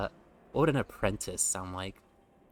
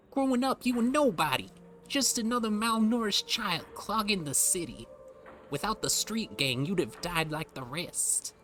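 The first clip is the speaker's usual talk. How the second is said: put-on much higher urchin voice in a mixed British and Southern accent; 11 semitones higher than usual. Reading the urchin backstory, high British-Southern urchin accent. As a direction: squeak it out like an orphan from old British shows. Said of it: "a mix between British and Southern U S".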